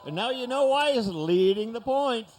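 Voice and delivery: high, loud thrilled announcer shout; crowd-pumping roar; excited shout. Real, not acted. Big pull, crowd excitement.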